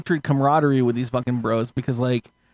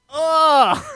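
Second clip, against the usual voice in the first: high, loud startled "Oh"; startled gasp; 12 semitones higher, 5 dB louder than usual. Retold or imitated. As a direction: jump at the sheriff. Startled self-quote in jail.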